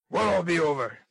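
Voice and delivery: loud, savage demand; fierce, bullying question. Acted. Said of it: "demanded Mister Sikes in a savage voice".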